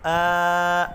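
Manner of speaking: آ with the mouth opened straight; an open, clear آ; zabar sound. Shown as a demonstration. Teacher sounding the zabar vowel for the students. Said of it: "زبر کی آواز نکالی جاتی ہے".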